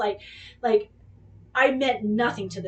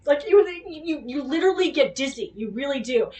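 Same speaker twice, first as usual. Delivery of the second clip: normal talking voice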